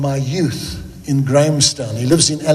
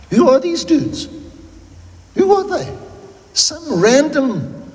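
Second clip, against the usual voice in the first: incredulous; 5 semitones higher than usual. Real, not acted. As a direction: scoff at these strangers in disbelief. Incredulous mocking questions.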